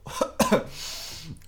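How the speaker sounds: harsh cough after inhaling. Real, not acted. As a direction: cough from the vapour. First puff on e-cigarette.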